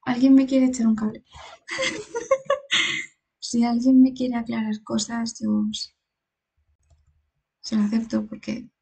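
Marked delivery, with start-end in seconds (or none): 1.7-3.0 high, thin nervous giggle; nervous high laugh. Real, not acted. Scared player asking chat for help, nervous giggle. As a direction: laugh off the fear.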